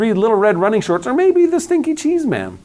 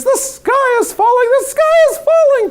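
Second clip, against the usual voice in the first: clucking hen; 4 dB louder than usual. A narrator's reading. Hen clucking her alarm to the world, high and frantic. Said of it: "clucked Chicken Licken".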